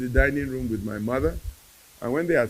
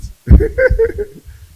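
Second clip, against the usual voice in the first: high, loud burst of laughter; high-pitched cackling chuckle; 13 dB louder than usual. Real, not acted. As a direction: laugh heartily at your own punchline. Laughs at his own punchline.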